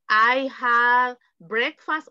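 drawn-out full sentence, marked as unneeded; slow wrong model answer. Shown as a demonstration. Teacher showing students the needless long answer, slowly. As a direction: stretch it out slowly as the answer not to give. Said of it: "no es necesario".

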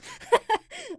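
shrill burst of laughter; high laugh. Real, not acted. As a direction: squeal with laughter. Laughs after acid tears.

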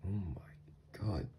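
hushed, drawn-out awe; awed whisper. Acted. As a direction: breathe it slowly, stunned by the look. Awed at partner's new look.

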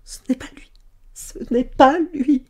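choked whisper breaking into high, loud wail; crying out. A narrator's reading. Servant girl denying it is Jacques, crying out. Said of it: "Elle s'écria".

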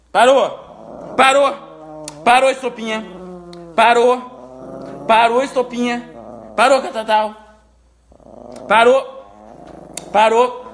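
loud firm commands, again and again; stern shout. Real, not acted. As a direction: order the dogs to stop. Separating fighting dogs.

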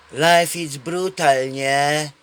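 slow, sighing mockery of a bored young man; imitating a jaded guy, slowly; mock world-weary drawl. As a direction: drag it out like nothing matters. Same guy quoted again.

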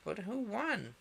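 high-pitched eager question; excited questioning. A narrator's reading. Panting animals ask the dodo, high and eager. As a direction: ask it high, as one of the crowd. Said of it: "and asked".

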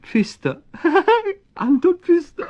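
high, giggling nervous introduction; nervous laugh. Acted. Man tells the talking cat his name, giggling nervously.

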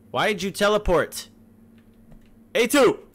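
high, loud demand; incredulous shout. Pretend. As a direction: shout after someone who vanished. Calling after fleeing character.

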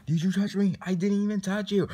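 high, loud indignant play argument; heated quarrel. Pretend. Two in line quarrel over touching, indignant.

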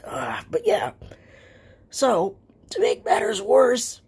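voiceless whisper; lost voice whisper. Real, not acted.

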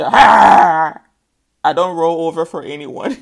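loud defiant refusal; defiant lion. Retold or imitated. Lion refusing the owner, loud defiant voice. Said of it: "You say".

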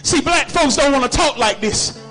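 ordinary talk

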